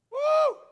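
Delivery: high, loud whoop; woo. Real, not acted. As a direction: whoop out loud mid-sermon. Preacher's own exclamation mid-sermon.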